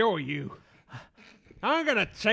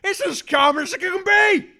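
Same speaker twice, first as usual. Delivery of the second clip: angry shouting; 7 semitones higher, 5 dB louder than usual. Acted. Farmer yelling at kid.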